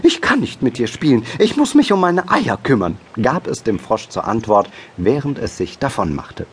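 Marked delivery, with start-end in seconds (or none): 0.0-3.0 higher, quick fussy hen voice; hurried hen. A narrator's reading. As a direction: brush someone off in a hurry as a hen. Hurried hen brushes frog off. (hohe, eilige Hühnerstimme)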